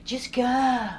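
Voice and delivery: sweet movie heroine. Retold or imitated. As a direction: play the brave friend from a movie, gently. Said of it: "she was so cute, it was like a movie".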